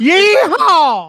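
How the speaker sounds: high mock Texan holler; cowboy whoop. Host caps the Texas joke with a mock cowboy whoop.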